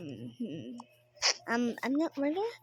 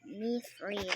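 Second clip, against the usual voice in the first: ordinary talk, nothing marked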